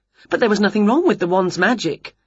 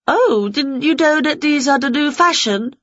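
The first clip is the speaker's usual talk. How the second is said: higher-pitched Winnie voice; pitched up, making an excuse; Winnie answering; 7 semitones higher than usual. A narrator's reading. Winnie explains her nose peg to auntie, higher voice.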